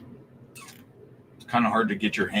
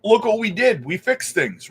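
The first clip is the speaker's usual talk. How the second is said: mock boasting politician; 10 semitones higher than usual. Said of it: "so that they can say".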